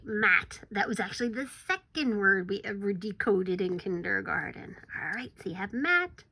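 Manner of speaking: ordinary talk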